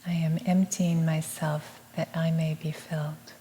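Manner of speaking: soft, measured meditative repeat; hushed affirmation, evenly spoken; affirmation echo. Real, not acted. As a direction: repeat it gently as a quiet response. Echoes the affirmation.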